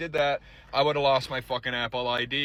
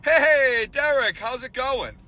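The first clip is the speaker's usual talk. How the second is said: high, slow fake-friendly greeting; mock friendly scammer; 10 semitones higher, slower than usual. Imitating the scammer answering the phone too casually, high and slow. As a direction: play the overly casual scammer. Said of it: "he answered the phone like".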